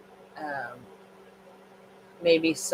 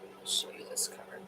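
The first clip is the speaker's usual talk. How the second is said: whisper; 14 dB quieter than usual. Real, not acted. Shows a card quietly.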